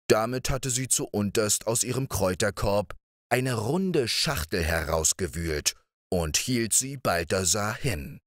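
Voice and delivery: ordinary delivery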